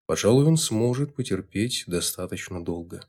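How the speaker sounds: ordinary delivery, nothing marked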